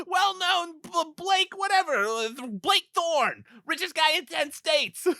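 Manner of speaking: high, slow mock-grand intro; mock announcer. The friend introduces Blake Thorne, as a high slow mock-grand intro. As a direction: present him like a celebrity.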